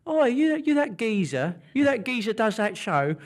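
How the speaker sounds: high, excited Belfast local; punter in the pub. Retold or imitated. Pub punter greeting the TV presenter excitedly. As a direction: play the starstruck pub punter. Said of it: "people are coming up to you in the pub and sort of saying".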